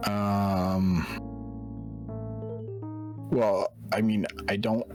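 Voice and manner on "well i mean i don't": normal talking voice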